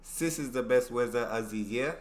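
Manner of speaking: learner imitating German "Sis" for this; German-accent try, "Sis" with an s; attempted German accent. Shown as a demonstration. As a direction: say "weather" with z in place of th. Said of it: "Am I getting close?".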